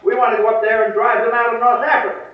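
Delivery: loud, commanding general's order; the general giving orders. Retold or imitated. General orders his lieutenant forward, loud and commanding. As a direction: bark it out like a blunt general.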